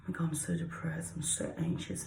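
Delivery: anxious whisper, barely breathed. Shown as a demonstration. Teaching kids what anxious sounds like, whispered. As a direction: whisper it like a worried wreck. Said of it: "Anxious means".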